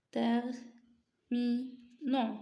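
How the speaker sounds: terminant drawn out very slowly; slow modelled terminant; stretched out. Shown as a demonstration. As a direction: drag terminant out so every sound is heard. Teacher models word pronunciation.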